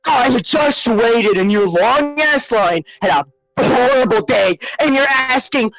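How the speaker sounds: ranting at the drive-thru worker, furious. Retold or imitated. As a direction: unload on the worker in a rage.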